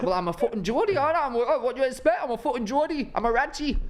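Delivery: high, rowdy mock Geordie boast. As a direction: ham up a proud Geordie lad. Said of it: "they'd be like".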